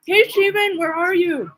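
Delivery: high, loud hail across a distance; calling out for a missing friend. Retold or imitated. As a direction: shout his name to find him. Calling out to Steven.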